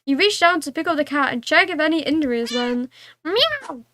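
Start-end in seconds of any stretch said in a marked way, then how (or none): 3.2-3.9 high sharp cat yowl; indignant meow. Pretend. Angry cat in story.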